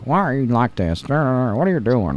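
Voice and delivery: put-on commentator voice, trailing into slow drawl; Jim Ross. Recalling the Mankind interview, comic drawn-out Jim Ross impression. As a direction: comically imitate the commentator, dragging out the last question. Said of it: "It was just J R being like".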